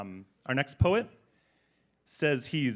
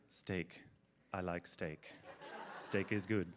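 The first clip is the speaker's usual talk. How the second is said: lower, softer deadpan reciting; mock recital; 5 semitones lower, 8 dB quieter, slower than usual. Host quotes a poet's silly steak poem, soft and deadpan. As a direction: quote the silly poem softly, half laughing. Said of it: "it went something like".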